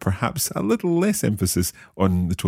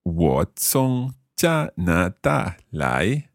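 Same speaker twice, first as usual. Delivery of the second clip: very slow, syllable by syllable; slow learner's repeat; much slower than usual. Shown as a demonstration. Learner repeating the teacher's Chinese phrase, very slowly. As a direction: draw out each tone carefully. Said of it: "saying each of these phrases quite slowly".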